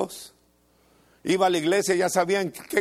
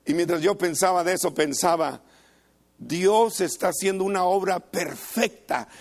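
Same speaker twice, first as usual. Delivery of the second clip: ordinary talk, nothing marked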